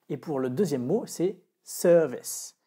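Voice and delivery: service with stress on SER, said slowly; slow demo of service; English service. Shown as a demonstration. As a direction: model service clearly, first syllable strong. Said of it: "Donc je refais la prononciation".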